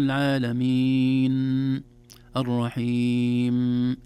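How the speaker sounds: العالمين with the final N held far too long, slow and loud. Shown as a demonstration. Sheikh shows the mistake of a long N, slow and loud. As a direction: drag out the N of العالمين. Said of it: "أن نضغط على حرف النون زمن طويل".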